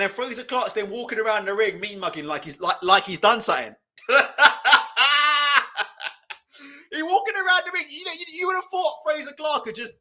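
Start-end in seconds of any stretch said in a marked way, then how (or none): 4.1-6.3 big burst of laughter, loud and high; cracking up at the boxer's strut. Real, not acted. Streamer cracks up at Frazer Clarke's strutting, loud laugh.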